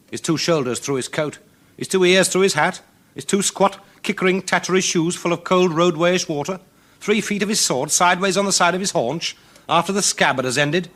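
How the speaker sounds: high, loud rattling description; storyteller mocks the shabby rascal; comic run. A narrator's reading. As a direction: rattle off his rags with relish. Comic ragged description, raised voice.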